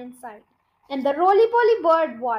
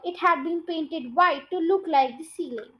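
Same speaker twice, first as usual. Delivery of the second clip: usual speaking voice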